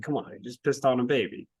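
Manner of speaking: ordinary talk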